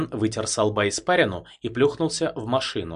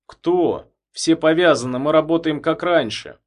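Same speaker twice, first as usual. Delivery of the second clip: protesting; 6 semitones higher, 7 dB louder than usual. A narrator's reading. Feldman protests.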